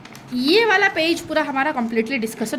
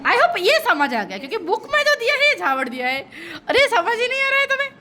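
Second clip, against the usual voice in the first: amused laugh; 7 semitones higher than usual. Real, not acted. Teacher laughing with class.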